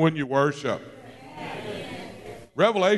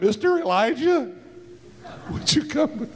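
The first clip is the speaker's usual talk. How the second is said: much higher, meek little voice; a cowed soldier, pitched high, with a laugh in it; timid soldier; 8 semitones higher than usual. Retold or imitated. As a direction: ask sheepishly, scared of him. Soldiers timidly summoning Elijah.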